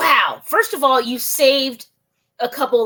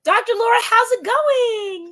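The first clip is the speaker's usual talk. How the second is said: bright greeting; 10 semitones higher than usual. Real, not acted. Host greets guest warmly, high.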